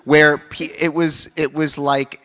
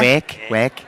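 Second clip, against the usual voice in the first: Scouse accent; 4 dB louder than usual. Shown as a demonstration. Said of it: "teach Elijah to do a Scouse accent".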